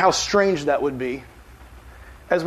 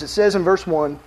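normal talking voice

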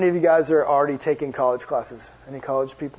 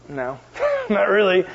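laughing reluctant no; 2 semitones higher, slower than usual. Retold or imitated. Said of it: "I'm like".

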